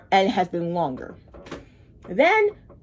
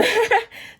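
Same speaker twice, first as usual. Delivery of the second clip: high laugh; 13 semitones higher than usual. Real, not acted. Laughs at own long story.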